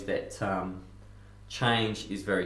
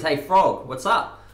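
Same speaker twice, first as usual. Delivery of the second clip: loud, high call across water; yelling; 7 semitones higher, 9 dB louder, slower than usual. Acted. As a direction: shout it out to get attention. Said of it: "He yells out to the frog".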